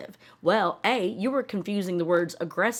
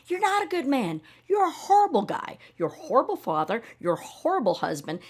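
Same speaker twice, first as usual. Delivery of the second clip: indignant outburst; 5 semitones higher than usual. Real, not acted. Angry outburst at Cody.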